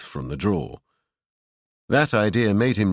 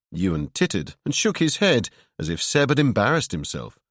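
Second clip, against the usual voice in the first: ordinary delivery, nothing marked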